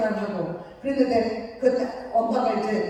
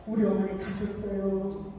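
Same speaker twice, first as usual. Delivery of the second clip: grieving news; 5 semitones lower, 6 dB quieter, slower than usual. Retold or imitated. Son reports mother's death.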